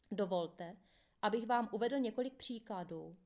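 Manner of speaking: usual speaking voice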